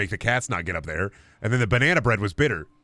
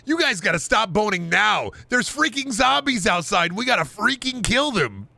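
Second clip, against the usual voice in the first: much higher, urgent nagging; zombie-obsessed roleplayer; 10 semitones higher than usual. Pretend. Streamer playing a forum pest, high and urgent. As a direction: plead like a dork who only wants zombies. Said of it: "break the E R P by saying".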